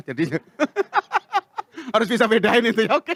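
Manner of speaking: ordinary talk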